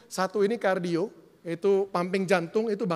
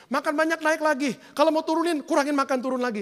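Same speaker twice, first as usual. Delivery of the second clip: high, louder recital of a vexing cycle; exasperated; 6 semitones higher, 4 dB louder than usual. Acted. Pastor voicing a dieter's endless up and down, exasperated. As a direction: push it up high in mock frustration. Mimics frustrating diet cycle.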